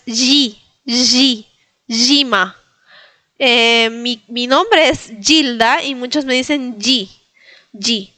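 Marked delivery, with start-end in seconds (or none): 0.0-2.6 yima as ZHEE-ma; soft zh sound. Shown as a demonstration. Teacher building the word by syllable, zh sound. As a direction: yi as a hushed voiced buzz plus i. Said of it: "no es z, sino es yi".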